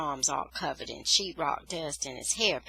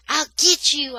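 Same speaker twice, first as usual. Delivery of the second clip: loud, much higher threat; angry yell; 9 semitones higher, 10 dB louder than usual. A narrator's reading. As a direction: bellow it after the fleeing dog. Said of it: "I yell".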